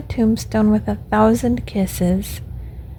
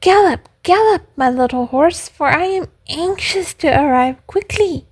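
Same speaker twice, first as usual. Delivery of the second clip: high, gleeful puppet voice; acted excited little puppet; joyful exclamation; 7 semitones higher than usual. A narrator's reading. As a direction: urge on the horse in high-pitched joy. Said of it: "he exclaimed joyfully".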